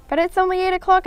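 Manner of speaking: high, whiny protest. A narrator's reading. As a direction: plead against bedtime. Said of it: "I protested".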